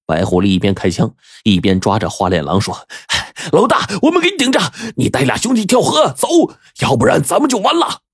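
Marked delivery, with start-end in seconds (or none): 3.5-8.0 high, rushed battle warning; frantic, high-pitched pleading under fire; urgent urging. A narrator's reading. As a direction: push the boss to flee now. Henchman urges boss amid gunfight.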